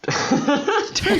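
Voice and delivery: high laugh through the word. Real, not acted. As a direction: break into laughter on turn. Laughing mid-sentence.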